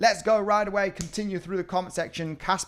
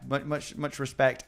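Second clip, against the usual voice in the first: ordinary talk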